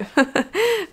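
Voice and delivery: high burst of giggles; self-mocking laugh. Real, not acted. Apologising to Mimi for her accent, high self-mocking laugh. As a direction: laugh at your own poor accent.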